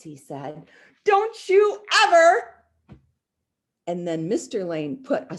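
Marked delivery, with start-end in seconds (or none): 1.0-2.5 high, loud playful scold; loud mock warning. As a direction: bellow it as a joking dad. Mister Lane playful warning.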